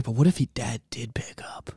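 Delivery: hushed breathy whisper, very low; soft whispered aside. Retold or imitated. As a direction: whisper it like a secret. Joke retold, Dad's line.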